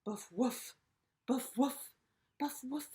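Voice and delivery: loud barking woofs; loud dog barks scaring robbers. Acted. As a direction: bark at the robbers. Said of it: "started to bark".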